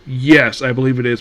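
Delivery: ordinary talk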